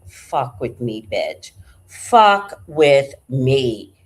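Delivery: low, slow menacing dare; the cat daring the street cat, low and slow; tough cat voice. Retold or imitated. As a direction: drag out the threat like a badass. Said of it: "My cat was like".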